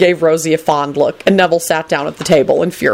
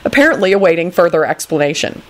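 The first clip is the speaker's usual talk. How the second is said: plain reading voice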